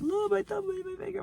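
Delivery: put-on very high, tearful child's whimper; crying little kid. As a stung child running to grandpa, high tearful whimper. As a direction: whine it like a hurt little kid.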